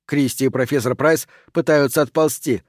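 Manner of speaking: ordinary delivery, nothing marked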